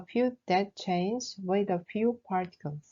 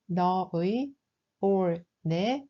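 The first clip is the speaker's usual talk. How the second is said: ordinary talk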